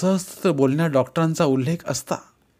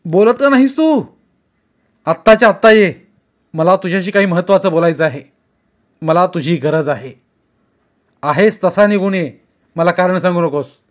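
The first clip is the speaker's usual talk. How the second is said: louder, higher, pressing hard; loud impatient demand; 5 semitones higher, 8 dB louder than usual. A narrator's reading. Kumar demanding Vaibhav come right away, loud and insistent. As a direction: insist he drop everything now.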